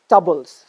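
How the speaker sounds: tables as TUB-bles, schwa for ay; reduced vowel. Shown as a demonstration. Teacher drilling the word ending, tables as tubbles. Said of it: "Why is it tables and not tables".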